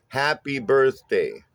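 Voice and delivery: "Happy birthday" slowly, HAP and BIRTH stressed; measured strong-weak beats on "Happy birthday"; trochaic rhythm. Shown as a demonstration. Bass teacher showing trochees, stressed-unstressed rhythm on "Happy birthday". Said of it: "stressed syllable followed by an unstressed syllable".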